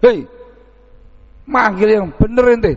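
a scolding "Hei", pitched high; mildly acted angry snap at the caller; angry retort. The man called by his nickname scolds the visitor, angrily. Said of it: "Ngamuk dianya".

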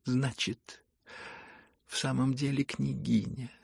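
plain reading voice

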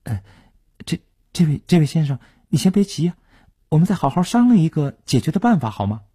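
high, flustered, halting plea; stammering. A narrator's reading. Cowed debtor begging departing collector to negotiate, stammering and flustered. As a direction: stumble into it, then hurry to placate. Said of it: "他结结巴巴地对作势离去的叶成坚说".

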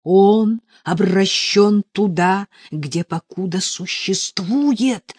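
slow, loud, solemn sorcerer's pronouncement; measured and forceful, as a wicked sorcerer; evil sorcerer. A narrator's reading. Sorcerer pronouncing on his captive, slow and menacing. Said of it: "говорит злой колдун".

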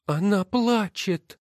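put-on high, slow girl's voice; child reporting. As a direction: tell father mother is crying. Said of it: "сказала Ноэми". (высокий медленный голос девочки)

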